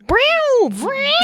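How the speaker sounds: high loud brow blasts; mock sound effect. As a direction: spit out cheesy sound effects. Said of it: "doing his own fucking sound effects".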